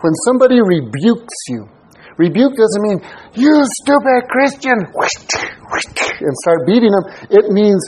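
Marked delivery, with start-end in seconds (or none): 3.3-4.8 mock insult. Mock harsh rebuke.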